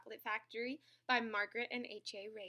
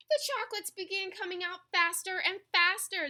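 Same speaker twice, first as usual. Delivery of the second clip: higher, louder storytelling as things speed up; excited narration; 10 semitones higher, 10 dB louder than usual. A narrator's reading. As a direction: build the rush for young listeners.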